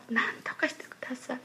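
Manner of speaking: quick whispered plea; 心の中の祈り. Retold or imitated. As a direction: whisper a hurried inward prayer under someone else's talk. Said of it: "母の言葉聞きながら". (早口のささやきで祈る)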